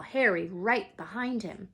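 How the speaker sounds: ordinary delivery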